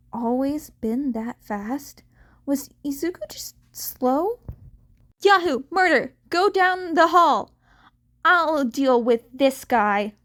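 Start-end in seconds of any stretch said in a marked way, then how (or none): 5.2-10.2 loud, urgent commands down the hall; louder, higher battle shout; shouted order. Acted. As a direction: bark orders over a fight. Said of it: "Mirako ordered".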